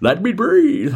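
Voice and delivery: high, badly faked villain impression; Bane. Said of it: "I can't do Bane very well".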